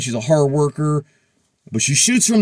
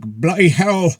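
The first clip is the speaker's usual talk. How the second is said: badly faked European accent; attempted European accent; slower than usual. Shown as a demonstration. Vlogger failing to do a European accent, mock indignant. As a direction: have a half-hearted go at a European accent. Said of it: "tried that in a European accent".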